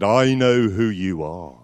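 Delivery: slow, spaced-out eerie phone voice. Retold or imitated. Mystery voice on the phone repeating itself, slow and eerie. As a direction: let each word hang ominously. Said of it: "and the voice said".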